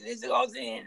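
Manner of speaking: put-on voice of a coked-up promoter; imitated wired promoter. Promoters addressing the band's manager, imitated wired voice. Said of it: "they've been doing blow for days".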